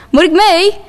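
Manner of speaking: mother calling after her daughter at the door. Acted. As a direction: call after your daughter. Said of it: "hoor ik mijn moeder roepen".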